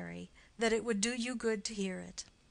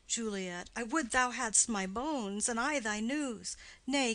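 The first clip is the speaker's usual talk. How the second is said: plain reading voice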